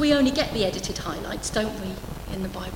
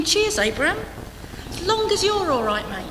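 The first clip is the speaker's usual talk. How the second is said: sarcastic Sarah; 6 semitones higher than usual. Retold or imitated. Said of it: "Sarah must have been thinking".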